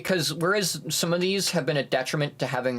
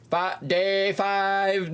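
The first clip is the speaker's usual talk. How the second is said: higher, very drawn-out announcement; imitating cartoon narration; 5 semitones higher, much slower than usual. Streamer recalling a SpongeBob episode, drawn-out announcement. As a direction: stretch the words out like a title card. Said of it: "that's that SpongeBob episode".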